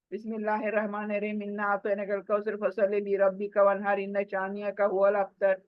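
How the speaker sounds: fast, slurred, mumbled Quran; hasty careless recitation; rushed recitation. Shown as a demonstration. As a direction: gabble it fast as in a hurried prayer. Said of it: "وہ کیا پڑھتے ہیں، کیا، کچھ بھی نہیں سمجھ".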